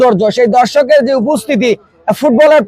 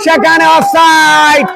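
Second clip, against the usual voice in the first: excited; 3 semitones higher, slower than usual. Real, not acted. Live football commentary, attack build-up.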